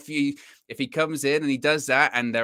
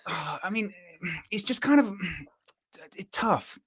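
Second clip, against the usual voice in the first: Simon Stone impression; 7 semitones higher, 5 dB quieter than usual. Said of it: "Simon Stone impression".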